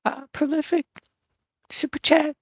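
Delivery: put-on high breathy girl voice; squeaky put-on girl voice. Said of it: "it's a girl's voice, not whispering".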